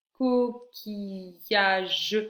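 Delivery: very slow, stretched "Coquillage"; syllable by syllable. Shown as a demonstration. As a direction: hold each syllable of "Coquillage" apart. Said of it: "ye sur la troisième syllabe".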